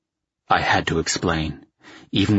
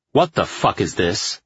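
understated angry snap; snapped; 2 semitones higher than usual. A narrator's reading. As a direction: snap it out with contained irritation. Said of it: "I snapped".

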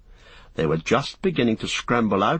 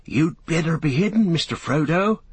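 higher, worried servant voice; anxious; 7 semitones higher than usual. Acted. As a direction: urge him to hide, anxious. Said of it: "said Sam anxiously".

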